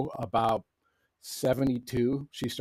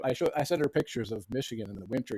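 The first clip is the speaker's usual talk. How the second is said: ordinary talk, nothing marked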